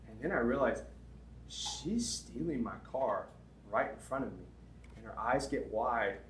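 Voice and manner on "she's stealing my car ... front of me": slow, soft, stunned realization; hushed disbelief as it dawns; quiet dawning realization. Retold or imitated. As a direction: let it sink in slowly. Sudden realization in retold story.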